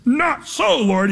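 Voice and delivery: mock emphatic Peter, jumping high on not so; a hard-headed no flung back at the Lord; emphatic refusal. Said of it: "saying it so emphatically".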